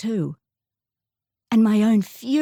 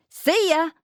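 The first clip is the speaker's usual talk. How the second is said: higher, light Aussie twang; 9 semitones higher than usual. Retold or imitated. Girl fitting in with local kids, light Aussie twang. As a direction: chirp it the Aussie way. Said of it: "with a strong Aussie twang".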